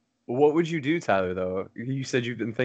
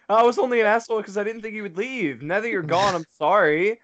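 higher, mock-pleading quote of someone apologizing; mock excuse-making; 8 semitones higher than usual. Friend mimicking a dad's apology for his daughter leaving, mocking.

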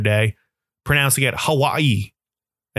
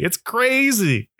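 "crazy" drawn out slow and much higher; stretched-out disbelief; 9 semitones higher, much slower than usual. Acted. To listeners, about coworkers backing him: slow, high disbelief. Repeated exclamation about Trump supporters.

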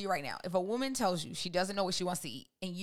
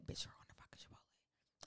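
dominating man's hushed command; whispered order; 22 dB quieter than usual. Acted. Boyfriend overruling her on Chipotle, whispered order. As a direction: lay down the law in a whisper. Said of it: "You gotta whisper it to you?".

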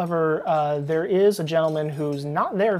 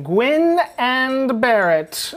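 higher, very slow chanted names; in unison; 8 semitones higher, 5 dB louder, much slower than usual. Acted. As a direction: draw the names out together like a jingle. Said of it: "and then in unison".

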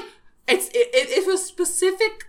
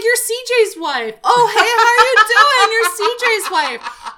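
high, loud, laughing greeting; friendly thief greeting; 10 semitones higher, 9 dB louder than usual. Retold or imitated. Thief greets the coworker's wife, high and laughing. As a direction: beam out a delighted hello. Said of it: "he's like".

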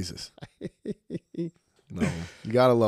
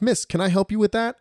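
polite gentleman; 12 semitones higher, 4 dB louder than usual. Retold or imitated. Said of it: "trying to be a gentleman".

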